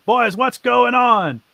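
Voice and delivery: a bandit boss shouting to his crew. Acted. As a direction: yell out to the boys.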